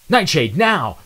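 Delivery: loud, high call to his teammate; shout. Acted. Zero shouts the signal to Nightshade, loud. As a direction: bark the signal. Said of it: "Zero shouted".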